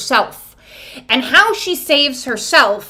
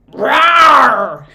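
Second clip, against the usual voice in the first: a little growled rawr, louder; playful dragon rawr; 5 dB louder than usual. Pretend. Tarot reader growling at viewers like a playful dragon. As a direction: play the dragon with a cute roar.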